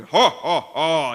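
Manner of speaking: loud booming giant laugh; giant's laugh. Retold or imitated. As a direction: bellow a big giant laugh. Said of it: "I would laugh like Goliath".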